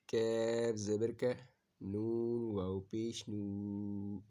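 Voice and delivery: usual speaking voice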